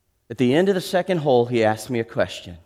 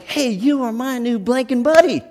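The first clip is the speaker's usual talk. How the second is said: high, friendly invitation; rough guy inviting the preacher golfing, eager and high; eager golf buddy; 11 semitones higher, 4 dB louder than usual. Retold or imitated. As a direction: ask a new buddy along, upbeat. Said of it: "and he's like".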